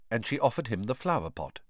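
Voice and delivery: ordinary delivery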